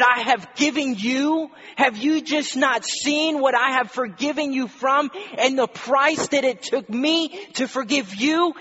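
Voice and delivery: high, loud, incredulous rebuke; indignant master. Acted. Master rebuking a servant who will not forgive, loudly indignant. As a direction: pour out hurt outrage at the ungrateful. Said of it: "the master stands and says".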